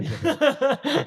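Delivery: high laughing aside. Real, not acted. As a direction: laugh it off, slow. Laughs off his own line.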